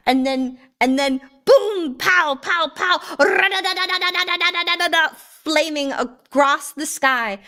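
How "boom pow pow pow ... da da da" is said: punched booms into rapid rattle; imitated explosions and gunfire. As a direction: mimic blasts, then machine-gun fire.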